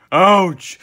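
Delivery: sudden high, loud cry of pain; loud pained yelp. A narrator's reading. Patrick stung by a jellyfish, loud acted yelp.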